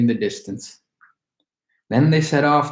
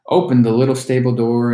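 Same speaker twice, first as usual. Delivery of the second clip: ordinary delivery, nothing marked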